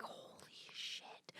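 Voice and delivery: breathless whispered awe; hushed, stunned whisper; awed whisper. Retold or imitated. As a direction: whisper it like you can't believe it. Awed reaction retold.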